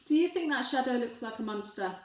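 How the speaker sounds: plain reading voice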